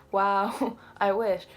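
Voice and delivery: laughing. Retold or imitated. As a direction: laugh out a wry reply. Laughing reply to mum.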